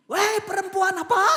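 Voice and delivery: loud, high Medan-style scolding; hammed-up Medan outburst; angry Medan accent. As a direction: imitate a Medan husband berating his wife. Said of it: "orang Medan lebih, lebih fasih lagi".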